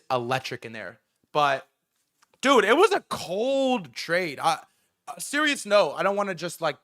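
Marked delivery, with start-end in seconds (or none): 2.4-4.4 high, slow, savoring every word; excited. Real, not acted. Streamer bragging to chat about the trade, excited. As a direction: drawl it out like a proud winner.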